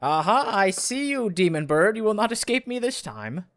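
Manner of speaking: high, loud theatrical challenge; triumphant grandiose inspector. Acted. Voices inspector challenging demon bird.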